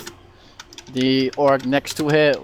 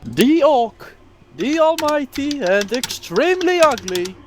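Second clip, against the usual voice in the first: much higher, goofy announcer voice; dopey cartoon bellow; 13 semitones higher than usual. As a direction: announce the orc like a cartoon fight host. Mock ring announcer intro.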